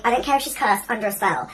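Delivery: normal talking voice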